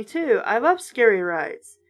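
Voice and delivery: plain reading voice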